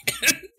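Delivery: high giggling laugh; a sudden high laugh at the screen; burst of laughter. Real, not acted. Streamer cracking up at a friend's drawing, high laugh.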